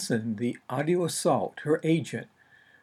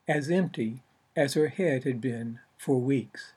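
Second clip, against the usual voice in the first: usual speaking voice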